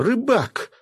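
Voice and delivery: громко и высоко позвать; окликнуть знакомого через толпу; окрик. A narrator's reading. (громкий высокий окрик по имени)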